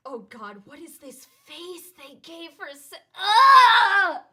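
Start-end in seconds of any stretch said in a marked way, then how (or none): 2.7-4.2 loud horrified shriek; shocked scream. Real, not acted. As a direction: scream in horror at the sight. Shocked by an ugly face.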